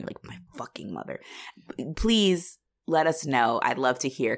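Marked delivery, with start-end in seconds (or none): 0.2-1.2 low, much softer aside; muttered thought. Acted. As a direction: grumble it under your breath. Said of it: "you were thinking that".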